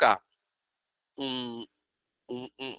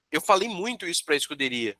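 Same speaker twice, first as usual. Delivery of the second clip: ordinary delivery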